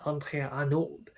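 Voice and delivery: mock French Andrea Arnold, r dropped; French accent. Shown as a demonstration. Vlogger mocks her own slip, in a French accent. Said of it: "in a French accent".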